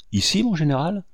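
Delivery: high-pitched astonished question; startled servant querying his general; utterly surprised. A narrator's reading. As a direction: ask it in disbelief, voice jumping up. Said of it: "s'écria le domestique au comble de la surprise".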